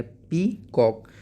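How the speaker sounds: Peacock broken into two drawn-out beats, pee... cock; split into syllables. Shown as a demonstration. Teacher breaking the word for pupils, syllable by syllable. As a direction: say peacock slowly, a pause between pee and cock. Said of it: "दो syllable में divide कर दिया".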